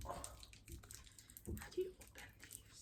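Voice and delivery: hushed whisper. Real, not acted. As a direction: whisper it under breath. Whispered aside.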